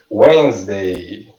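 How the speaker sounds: slow Wednesday, "WENZ-day"; correct pronunciation. Shown as a demonstration. Teacher asking learners to repeat, slow "wenz-day" for Wednesday. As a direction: stretch out Wednesday in two syllables, silent D. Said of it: "So, repita comigo".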